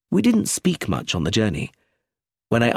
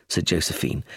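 plain reading voice

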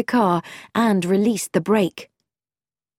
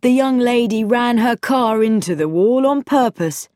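higher, mock-informative sarcasm; sarcastic; 4 semitones higher, 3 dB louder than usual. A narrator's reading. George humours the gawping boy, lightly sarcastic. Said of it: "said George sarcastically".

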